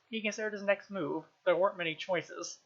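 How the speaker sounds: plain reading voice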